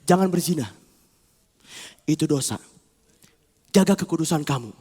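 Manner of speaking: low, measured command; solemn warning with long pauses; firm spirit voice. Acted. Strengthened spirit warning the soul, low and deliberate. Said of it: "dia yang lebih besar".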